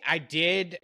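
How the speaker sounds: ordinary talk, nothing marked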